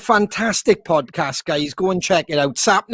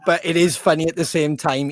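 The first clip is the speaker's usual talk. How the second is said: ordinary talk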